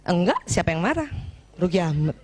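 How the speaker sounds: low, put-on wifely denial; mock denial. Angry wife denying it to her husband, low mock denial. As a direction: deny being angry, half playing it. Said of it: "dan dibilang enggak".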